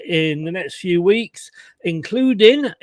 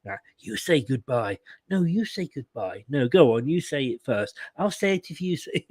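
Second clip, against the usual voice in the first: mock lovey-dovey phone goodbye; 4 semitones lower, 8 dB quieter than usual. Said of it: "saying goodbye to Chloe on the phone".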